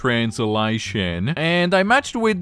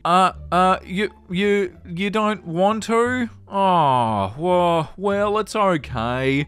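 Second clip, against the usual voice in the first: small, higher voice, haltingly let down; hurt stammering; 4 semitones higher than usual. Acted. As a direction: act out crushed, faltering acceptance. Reads a rejected role-player's reply.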